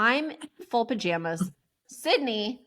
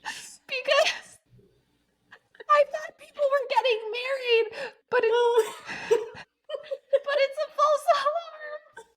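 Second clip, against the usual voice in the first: much higher, breaking into real laughter; laughing while talking; slower than usual. Real, not acted. Admitting the engagement was a false alarm, really laughing. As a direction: squeak the words out through laughs.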